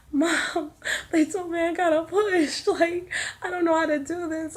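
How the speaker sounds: high-pitched mock tearful wail; retold crying. Daughter phoning her mom before pushing, tearful. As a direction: whine it helplessly, as if crying to a parent. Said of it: "I was crying my whole".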